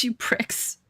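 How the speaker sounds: whispered insult. Pretend. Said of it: "Stop making fun of my accents".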